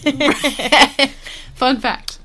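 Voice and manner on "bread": bleating like a sheep. Retold or imitated. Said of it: "sheep sound like they're saying bread".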